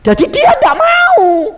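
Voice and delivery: preaching shout. Real, not acted. Preacher shouts that Jesus did not want it. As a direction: drive the point home.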